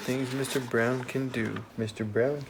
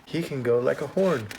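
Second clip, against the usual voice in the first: ordinary delivery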